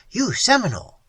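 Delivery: loud, high emphatic claim. A narrator's reading. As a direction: declare it firmly. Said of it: "he said emphatically".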